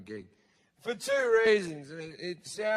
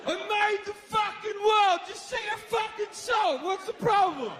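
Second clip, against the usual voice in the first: much higher ranting shout; furious God; 12 semitones higher than usual. Acted. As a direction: blast it like an offended almighty. Comic God rants at followers.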